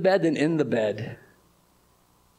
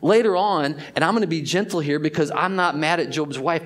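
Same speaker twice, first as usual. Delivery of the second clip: normal talking voice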